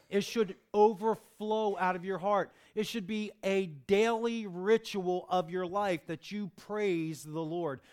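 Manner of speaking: loud, rising preacher's exhortation; fervent preaching. Acted. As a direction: drive it home to the congregation. Preaching at full force.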